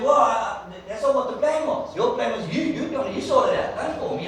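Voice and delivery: high, loud flustered stammer; mock flustered Moses; flustered protest. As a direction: stumble over your words in alarm. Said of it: "He said".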